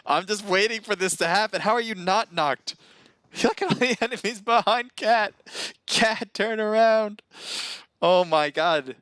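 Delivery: high, excited exclamations with laughter; amused disbelief. Real, not acted. Disbelief as player misses nearby enemies.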